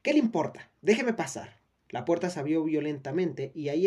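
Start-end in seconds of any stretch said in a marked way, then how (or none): 0.0-1.6 loud, high, angry retort; angry snap. A narrator's reading. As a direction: snap back at her, loudly. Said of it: "defiant woman at door".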